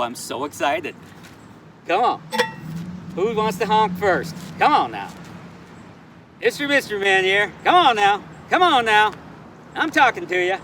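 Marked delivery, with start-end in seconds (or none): 1.9-10.6 loud, high calls for passing drivers to honk; calling out. Real, not acted. Vlogger hollering at passing cars to honk for donations, loud and high. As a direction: call out to the street, begging for honks.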